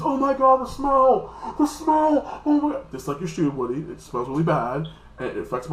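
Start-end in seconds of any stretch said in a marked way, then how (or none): 0.0-2.9 high, loud hammed-up gagging panic; comic panic over a foul smell; mock disgust at a smell. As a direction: shriek about the stink like it burns your nose. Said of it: "the fox is like".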